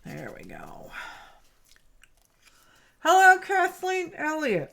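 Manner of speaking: talking around a pin; words round pins held in lips; pins in mouth. Real, not acted. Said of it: "I have a pin in my mouth".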